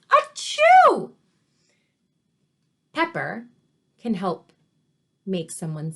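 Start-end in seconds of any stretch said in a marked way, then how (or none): 0.1-1.1 loud, high-pitched mock sneeze; acted sneeze. A narrator's reading. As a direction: burst out with a big storybook achoo. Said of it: "Her husband sneezed".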